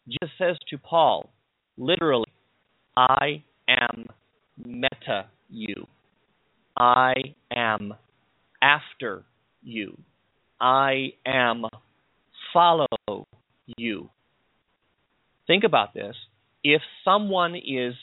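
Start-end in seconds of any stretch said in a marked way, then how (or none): 2.9-14.1 deliberate, spaced-out literal rendering; word-by-word emphasis. Shown as a demonstration. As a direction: set each word apart with long pauses.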